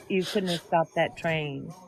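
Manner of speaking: usual speaking voice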